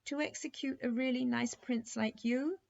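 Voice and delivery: put-on a little girl close to tears over the prince; upset little princess. Princess upset about prince's execution.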